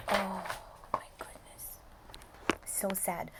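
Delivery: hushed, sorrowful whisper; sad whisper. Real, not acted. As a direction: whisper it as if the news is too sad to say aloud. Reacting to sad story moment.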